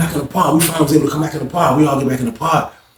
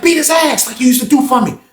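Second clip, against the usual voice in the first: pitched-up cussing [name]; high mock cursing; 9 semitones higher than usual. [name] cursing at Lawrence in a high mock voice. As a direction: snap back in a high put-on voice.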